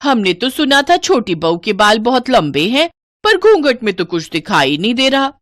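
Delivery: high, lilting, nosy remark; pry playfully about the bride's hair; curious woman guest. Acted.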